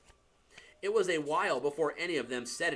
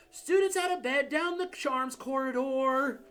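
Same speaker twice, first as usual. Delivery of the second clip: bellowing; 10 semitones higher than usual. Acted. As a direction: shout it down the corridor. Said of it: "Peeves bellowed".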